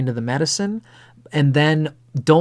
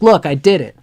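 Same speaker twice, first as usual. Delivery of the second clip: put-on proud kid; 4 semitones higher, 7 dB louder than usual. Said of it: "He's like".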